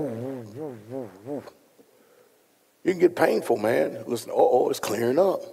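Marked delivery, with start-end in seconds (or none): 0.0-1.4 soft falling-off woo sounds imitating ringing ears. Shown as a demonstration. Preacher imitating his ringing ears to the congregation, soft woo. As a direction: mimic the ringing in his ears, barely voiced. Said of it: "my ears are going woo".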